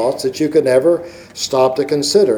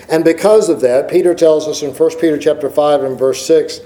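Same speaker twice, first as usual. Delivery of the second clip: normal talking voice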